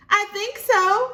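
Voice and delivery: put-on high, slow, uncertain little girl; child voice. Libby answering Mama's question, as a high unsure child.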